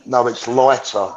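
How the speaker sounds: ordinary delivery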